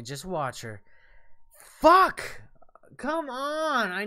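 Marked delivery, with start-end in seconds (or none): 1.8-2.4 sudden furious shout of fuck; angry curse yell. Real, not acted. Gamer yelling at a boss who hit him. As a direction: explodes at the game. Boss fight rage burst.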